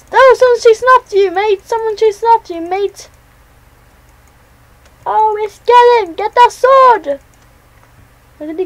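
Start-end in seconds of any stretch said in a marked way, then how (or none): 0.1-7.2 high, loud hype calls; excited shouting. Real, not acted. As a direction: cheer the chase on. Excited calling during a chase.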